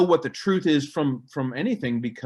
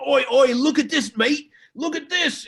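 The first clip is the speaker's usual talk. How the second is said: mock cockney British, high and loud; British geezer shouting oi oi; hammed-up London accent; 10 semitones higher, 5 dB louder than usual. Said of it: "famous British guy and he's going".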